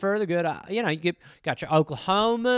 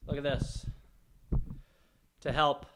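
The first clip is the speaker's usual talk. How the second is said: ordinary talk, nothing marked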